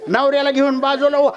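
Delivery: high, pressing acted warning; insistent neighbour calling a warning; urgent warning. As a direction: urge her out of danger. Neighbour urges wife to flee fire.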